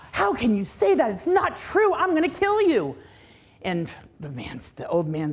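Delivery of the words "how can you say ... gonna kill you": high, loud, outraged king; king snaps at the old man who insulted him; furious king. Acted. Angry king raises his voice at wise man. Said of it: "the king got furious".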